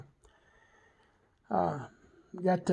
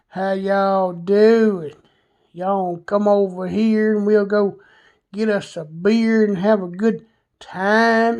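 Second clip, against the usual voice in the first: very slow, stretched Southern drawl; imitating a friend's long Southern drawl; much slower than usual. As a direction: drag every Southern word out lazily. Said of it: "drawn out drawl, that Southern drawl".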